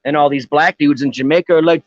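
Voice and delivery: ordinary delivery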